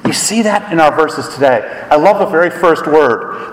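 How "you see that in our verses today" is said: ordinary delivery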